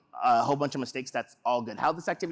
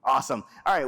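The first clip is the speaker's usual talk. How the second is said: normal talking voice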